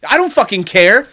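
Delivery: loud, high, worked-up rant; yelling it out with fired-up glee; excited shouting. Real, not acted. Excited rant about a free idea.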